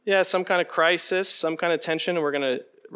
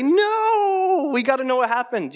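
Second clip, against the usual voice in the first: put-on high pleading child's protest, louder; whining kids; 11 semitones higher, 5 dB louder, slower than usual. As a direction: beg like a kid who wants the ending. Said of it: "they'll say".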